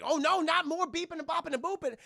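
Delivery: high, loud comic alarm; mock dismay. Voicing onlookers as the glitching restarts, mock alarm. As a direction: wail it in exaggerated dread.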